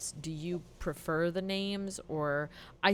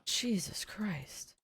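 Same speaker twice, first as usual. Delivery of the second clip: breathless whisper; 3 semitones higher, 6 dB quieter, much slower than usual. Real, not acted. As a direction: mutter it under your breath. Stunned reaction at the table.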